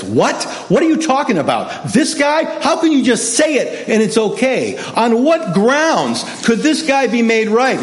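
high, emphatic mock disbelief; incredulous objection. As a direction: challenge it as a baffled objector, pitch jumping up.

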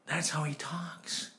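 slow, whispered dawning thought; hushed realisation. Retold or imitated. Preacher realising his friend really lisps, whispered. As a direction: whisper it slowly as it sinks in.

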